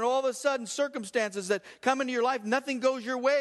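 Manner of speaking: high and rising, full preaching voice; preaching at full force. Acted. Preacher driving the point home to the church. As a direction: build the sermon to a peak.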